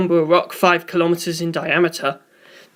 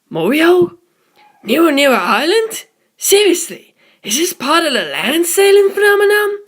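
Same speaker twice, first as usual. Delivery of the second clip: much higher and louder, incredulous; can't believe their ears; astonished crewman; 10 semitones higher, 4 dB louder than usual. A narrator's reading. A crew member reacts to the strange place names, high and incredulous.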